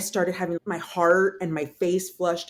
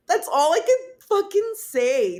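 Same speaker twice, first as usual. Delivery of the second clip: exasperated; 10 semitones higher, 7 dB louder, slower than usual. Real, not acted. Exasperated at her own helplessness.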